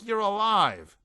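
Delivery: high, loud and frantic; hysterical. A narrator's reading. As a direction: cry it out in hysterics. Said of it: "She was still hysterical".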